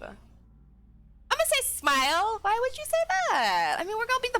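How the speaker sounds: sing-song innocent why; high playful voice. Acted. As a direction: play dumb in a high voice. Voicing chosen reply.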